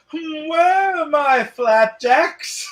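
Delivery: very slow, high and loud; cartoon-style flapjack demand; Family Guy quote. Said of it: "any Family Guy fans in here?".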